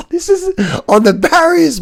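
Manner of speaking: high, breathless laugh over the words. Real, not acted. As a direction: crack up while talking. Laughing at rider on barriers.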